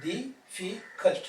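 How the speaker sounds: difficult slowly as di, fi, cult; short pauses between syllables. Shown as a demonstration. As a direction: pause briefly after each syllable of difficult. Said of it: "short pauses are coming".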